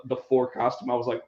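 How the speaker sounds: ordinary talk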